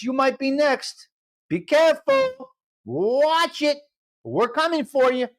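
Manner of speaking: high, drawn-out teasing warning; mock menace.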